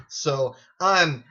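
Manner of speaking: normal talking voice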